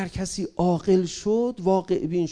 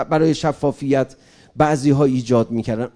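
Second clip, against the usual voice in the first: ordinary delivery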